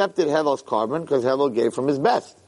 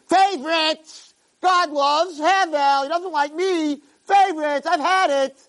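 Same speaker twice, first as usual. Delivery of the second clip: put-on much higher, slow childish whine; whiny Cain; 12 semitones higher, slower than usual. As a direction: wail that it's unfair. Cain whining about favoritism.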